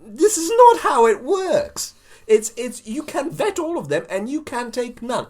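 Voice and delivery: high, loud, imploring outburst; exasperated high-pitched yell; exasperated Turnbull. Acted. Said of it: "Mister Turnbull tries to say".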